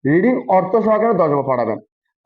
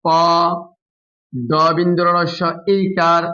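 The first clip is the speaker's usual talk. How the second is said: slow spelling out; slower than usual. Shown as a demonstration. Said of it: "বানান উচ্চারণ একসাথে".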